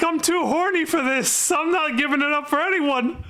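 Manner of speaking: mock-lecherous high voice; horny young Lang.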